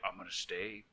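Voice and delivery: soft unvoiced creepy whisper. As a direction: breathe it like a stalker. Creepy intruder imitation.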